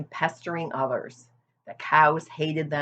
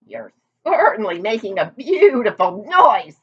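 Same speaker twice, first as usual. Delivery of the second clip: louder, sharp sarcasm; sarcastic snap; 6 semitones higher, 9 dB louder than usual. A narrator's reading. Old sheep snapping at noisy Wilbur, louder. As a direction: snap it at the wailing pig. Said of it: "snapped the old sheep".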